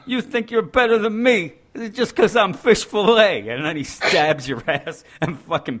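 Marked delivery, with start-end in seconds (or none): 0.1-3.5 mock tough greaser, higher and louder, laughing through it; The Fonz. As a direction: sneer it like a fifties greaser while cracking up. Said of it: "The Fonz".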